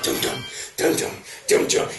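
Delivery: spaced drumbeat thuds, voiced by mouth; tense soundtrack. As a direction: imitate ominous film-score beats. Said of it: "the tension starts to ratchet up".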